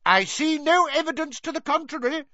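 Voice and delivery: very high-pitched furious denial; angry shout. A narrator's reading. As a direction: shout it back in anger. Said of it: "shouted Fudge".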